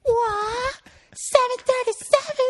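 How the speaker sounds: very high falsetto scream, held back in volume; squealed, strained mock yell; mock group scream. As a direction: imitate a whole warehouse screaming. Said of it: "screamed at the top of their lungs".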